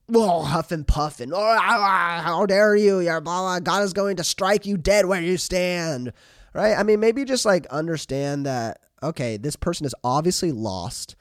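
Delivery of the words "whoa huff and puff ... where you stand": hammed-up huffing indignation, higher and louder; mock outraged believer. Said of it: "Instead of acting like".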